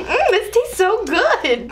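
high, slow, overdone yum; mock delight. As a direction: savor it with fake bliss. Tasting very sour powder.

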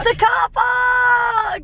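high, slow, excited shout; mock excitement. Real, not acted. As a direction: gush over it sarcastically. Mock excitement at car park.

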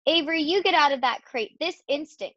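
high, scolding mother's order; cross mother, read aloud; stern command. A narrator's reading. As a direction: order the child out firmly. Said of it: "commanded his mother".

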